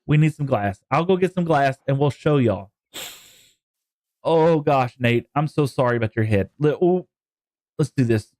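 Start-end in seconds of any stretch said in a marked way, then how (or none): none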